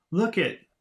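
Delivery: demonstrated burst of surprise; surprised. Shown as a demonstration. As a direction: blurt it out like an amazed child. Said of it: "if you're really surprised".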